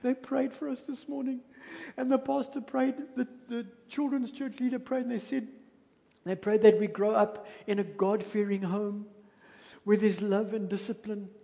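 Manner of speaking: put-on a small boy explaining tearfully from the back seat; little boy. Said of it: "He says".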